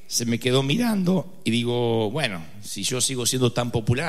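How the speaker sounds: normal talking voice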